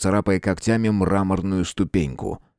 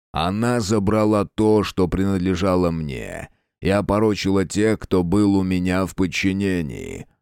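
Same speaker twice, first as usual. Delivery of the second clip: dark lord. Acted.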